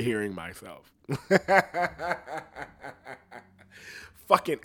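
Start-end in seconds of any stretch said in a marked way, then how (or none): 1.1-3.1 laughing. Real, not acted. Laughs at own rambling.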